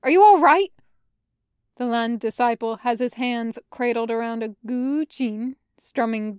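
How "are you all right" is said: high, loud, urgent concern; worried shout. Acted. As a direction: call out anxiously to a friend. Jinling checks on fallen friend.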